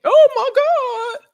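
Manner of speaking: high, loud, slow dramatic wail; mock panic. The woman panicking as intruders burst in, high and slow. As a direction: gasp it out in hammed-up terror.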